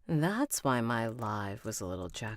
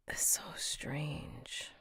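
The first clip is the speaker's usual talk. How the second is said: whisper; 9 dB quieter, much slower than usual. Real, not acted. Muttering to self while setting up.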